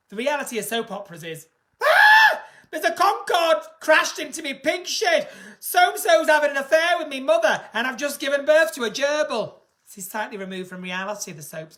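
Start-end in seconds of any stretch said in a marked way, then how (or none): 1.8-2.4 very high, loud hammed-up shriek; mock soap scream. Soap character dramatic scream. 2.7-9.6 loud, overwrought Northern soap voice; soap melodrama. Pretend.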